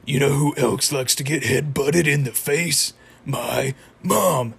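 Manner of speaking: put-on cartoon voice for a taunting joke; Muscle Man. Fan imitating Muscle Man's My Mom joke, taunting cartoon voice. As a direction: deliver the catchphrase in a cartoon voice. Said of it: "a traditional My Mom joke from Muscle Man".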